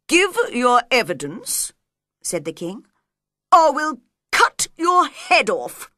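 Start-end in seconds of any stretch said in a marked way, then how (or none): 0.1-1.7 loud, firm royal demand; commanding king. Acted. King orders the frightened Hatter. 3.5-5.9 loud, high royal threat; threatening king. Acted.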